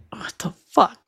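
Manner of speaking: hushed disbelief. Real, not acted. Stunned reaction, hushed.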